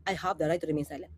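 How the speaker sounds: mock evasive complainant. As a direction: mutter it low like a stonewalling suspect. (mahina at mababang pag-iwas)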